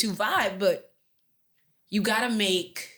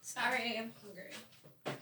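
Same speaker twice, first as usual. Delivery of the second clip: quiet off mic; 14 dB quieter, much slower than usual. Real, not acted. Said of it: "I can still hear you".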